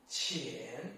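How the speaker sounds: "qian" dipping very low, then rising; soft low scoop on "qian"; third tone. Shown as a demonstration. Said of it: "third tone".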